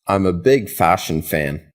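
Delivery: ordinary talk, nothing marked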